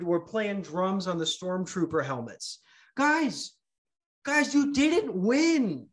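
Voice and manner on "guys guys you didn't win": higher, slow, drawn-out reprimand to the audience; mock scolding. Real, not acted. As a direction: break it to them slowly. Addressing audience mockingly.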